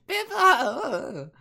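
high, drawn-out creature cry of its own name; stunned Piplup. Acted. Game master voices Piplup after its ears are bopped, stunned high cry. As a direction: squeal it like a dazed little Pokemon. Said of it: "Piplup's just kinda stunned".

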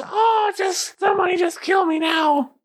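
very high whiny wail; despairing goblin. Acted. Wounded goblin begs to be killed, despairing wail. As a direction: whine it in a squeaky goblin falsetto.

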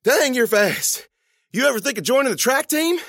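high, loud, amazed student; impressed exclamation. Acted. Student to the boy just caught at the bus, impressed exclamation. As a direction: exclaim it high with winded admiration. I was breathing pretty heavy.

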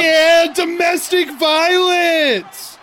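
high, slow shout; excited shout. Real, not acted. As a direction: yell it out slowly.

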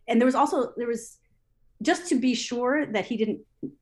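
normal talking voice

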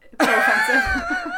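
high, loud, helpless laughter through the words; squealed through laughter; real laughing fit. Real, not acted. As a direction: crack up in embarrassment while saying it. Cracking up over own accent attempt.

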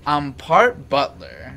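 put-on slow, drawn-out kid announcement; self-important kid. Kid explaining his show and tell outfit, self-important. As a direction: imitate a kid proudly presenting himself.

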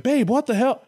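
high, incredulous mock shock; hammed-up disbelief; startled Lucifer. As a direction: play a baffled boyfriend, pitch jumping up. Acted Lucifer's shock at Lilith hurled out.

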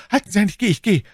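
panicked soldier giving in; terrified cry. A narrator's reading. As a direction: cry out in fright, voice jumping up. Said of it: "indem ihm der Schrecken".

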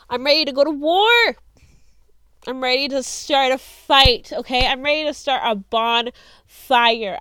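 loud, rallying shout; worked-up, punchy call to arms; fired-up battle cry. Retold or imitated. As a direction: declare war at full volume. Rallying battle cry she recalls thinking.

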